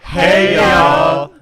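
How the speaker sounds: loud, high southern drawl; southern yell. Acted. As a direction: belt out a southern hey y'all. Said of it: "ask her to play us out".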